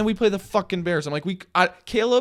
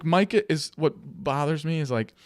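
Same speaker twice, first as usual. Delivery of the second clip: ordinary talk, nothing marked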